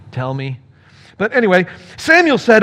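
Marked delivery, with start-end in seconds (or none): 0.1-0.6 "Tell me" much softer and lower; a quiet, reluctant "Tell me"; softer 'Tell me'. Shown as a demonstration. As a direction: drop "Tell me" to a hushed, wary mutter. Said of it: "what tone of voice Saul used".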